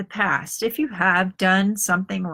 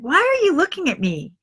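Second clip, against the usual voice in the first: higher, puzzled boy's voice, lightly acted; imitating young son; 9 semitones higher than usual. Mother imitating her son asking why she stares, higher boyish voice. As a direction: play the wary kid caught being stared at.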